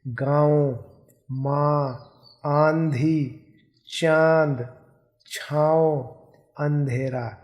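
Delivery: "गाँव" "माँ" with clearly nasal vowels; nasalized vowels demo. Shown as a demonstration. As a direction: let air through the nose on "माँ". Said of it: "इसका उच्चारण ध्यान से देखिए".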